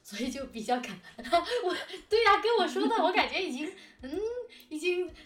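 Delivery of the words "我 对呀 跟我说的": usual speaking voice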